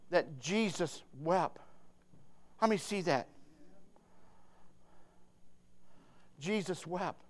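low, very soft preaching; hushed solemnity. Real, not acted. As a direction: let the verse sink in quietly. Preacher dwells solemnly on verse.

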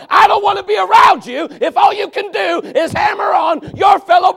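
shouted high, relentless pulpit tirade; full-force preaching. Real, not acted. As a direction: build to a roaring rebuke of the congregation.

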